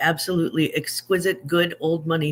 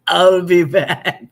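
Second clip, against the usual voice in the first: Arnold impression; 9 dB louder, slower than usual. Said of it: "Germans know Austrians sound funny".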